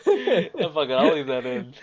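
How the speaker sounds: normal talking voice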